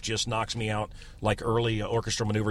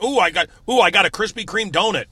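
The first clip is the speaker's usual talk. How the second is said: high, loud smug bragging; mock a show-off; mocking brag; 6 semitones higher, 5 dB louder than usual.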